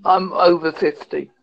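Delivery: usual speaking voice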